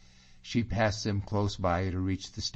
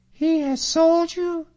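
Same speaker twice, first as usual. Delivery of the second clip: very high, loud, slow question; Katrina's voice; 10 dB louder than usual. Acted. Katrina asks Allan about the betrayal, very high and loud. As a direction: ask it far up in a woman's voice.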